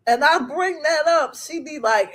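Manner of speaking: normal talking voice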